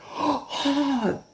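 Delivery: very high delighted voice; gleeful Stevie. Retold or imitated. Stevie relishing the mess, very high mock delight. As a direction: sing it out high and pleased. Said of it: "And he goes".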